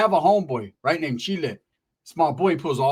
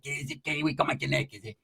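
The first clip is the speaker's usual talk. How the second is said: stoned Pepe. Retold or imitated. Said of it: "He's like".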